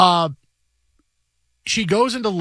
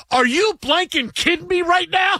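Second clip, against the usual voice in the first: much higher mock-shrieked outrage; imitating angry wife; 13 semitones higher than usual. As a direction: do his wife's furious disbelief. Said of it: "I can totally hear Pam saying that".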